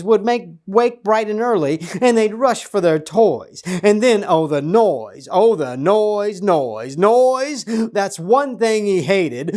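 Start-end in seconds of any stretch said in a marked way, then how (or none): 3.8-7.6 exasperated storyteller building on noise; rising exasperation. A narrator's reading. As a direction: pile up the noises, climbing in pitch. Building complaint about the noise.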